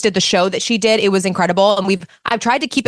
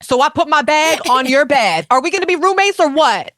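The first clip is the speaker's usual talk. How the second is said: New York; 6 semitones higher than usual. Retold or imitated. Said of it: "New York was like".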